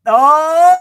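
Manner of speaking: high, very loud "oh"; startled cry. Real, not acted. Reacting to the card, loud high cry. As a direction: cry out at what turned up.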